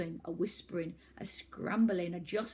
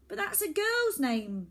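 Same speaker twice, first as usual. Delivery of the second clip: high, loud blurted jibe; 8 semitones higher, 5 dB louder than usual. Acted. Boy blurts out a jibe at the villain, high and loud. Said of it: "blurted Oliver".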